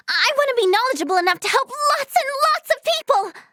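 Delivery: fast, high-pitched eagerness; overexcited. Acted. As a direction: rush it out, carried away by your dream. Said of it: "didn't mean to get so excited".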